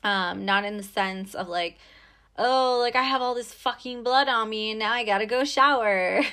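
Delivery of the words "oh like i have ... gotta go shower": louder, higher mock disgust. Guy complaining about blood on him, mock disgusted. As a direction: whine it in exaggerated disgust. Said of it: "don't be disgusted".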